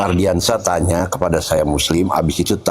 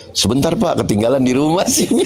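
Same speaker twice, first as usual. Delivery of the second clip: caught driver excuse; 8 semitones higher than usual. Retold or imitated. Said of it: "Begitu Oten".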